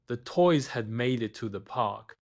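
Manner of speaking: usual speaking voice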